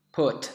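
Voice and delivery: "put" with a crisp puff on the p; English p demo. Shown as a demonstration. Teacher modelling the p sound, careful English "put". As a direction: say "put" as a separate model word. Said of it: "Kita coba misalnya bunyi".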